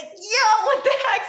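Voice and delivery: very high, loud, half-laughing protest; comic outraged squawk of disbelief; mock shock. Acts out shock at opponent's attack.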